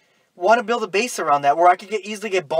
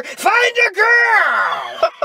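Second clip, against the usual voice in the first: sudden high, drawn-out villain yell; mad villain shout; 14 semitones higher, 4 dB louder, much slower than usual. Retold or imitated. Villain brother repeats the order to his henchman, long mock-furious shout. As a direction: imitate a villain exploding, stretching every word. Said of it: "I talk really loud".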